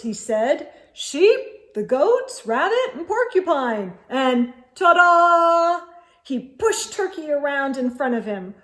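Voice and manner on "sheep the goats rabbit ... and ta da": high, loud showy presenting; showy announcing. A narrator's reading. Moose presents guests and turkey to wife, showy announcing. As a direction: present it with a flourish.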